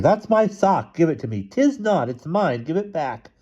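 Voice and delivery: put-on high-pitched bickering kids; snatch it back in a high child's voice; high, insistent sibling quarrel; children squabbling. A narrator's reading. Children squabbling over clothes, read aloud.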